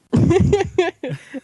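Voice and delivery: loud high laugh. Real, not acted. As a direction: burst out laughing. Laughing at own joke.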